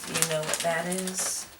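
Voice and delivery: normal talking voice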